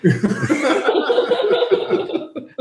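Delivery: high giggling laughter; can't hold the laugh in; breathless giggle. Real, not acted. Player laughs while stomping.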